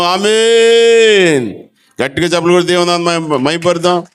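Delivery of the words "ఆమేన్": drawn-out amen. Real, not acted. Preacher closing scripture reading.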